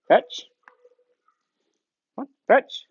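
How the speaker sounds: ordinary delivery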